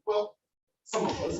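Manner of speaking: normal talking voice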